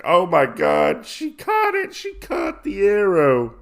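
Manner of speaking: high-pitched, hammed-up astonishment; shocked informant. Informant stunned that she caught the arrow, mock shock. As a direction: squeal it out in mock disbelief. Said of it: "He is so surprised and shocked".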